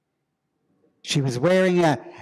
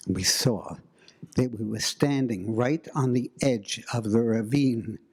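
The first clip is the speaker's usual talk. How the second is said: ordinary delivery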